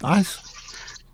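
high, teasing mock outrage; mock shocked teasing. Teasing friend on toilet.

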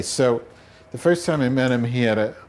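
usual speaking voice

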